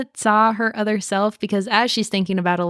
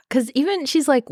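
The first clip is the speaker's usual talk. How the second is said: ordinary talk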